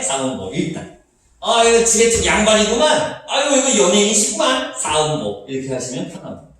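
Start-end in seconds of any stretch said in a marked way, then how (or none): 1.4-4.8 higher, louder playful recognition; hammed-up 'well, look who it is'; lightly acted knowing onlooker; mock exclamation. Mock reaction to hearing upper class.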